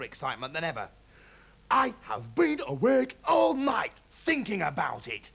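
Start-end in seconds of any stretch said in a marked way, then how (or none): 1.7-5.3 high, loud, slow and eager; dad announcing his night of plans; excited cry. A narrator's reading. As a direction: burst in full of plans. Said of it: "he cried".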